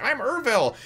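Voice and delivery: high-pitched goofy character; other head's voice. Retold or imitated. The other of three dudes chiming in, high and silly. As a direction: announce the name in a silly high voice. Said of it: "the other one's like".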